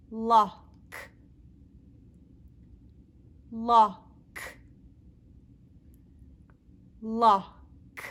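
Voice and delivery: lock, with a firm clear L; teacher repeating lock; modelled L word. Shown as a demonstration. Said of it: "Practice with me".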